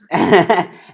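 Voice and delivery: loud high laugh breaking in; burst of laughter. Real, not acted. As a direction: crack up mid-sentence. Laughs mid donation plea.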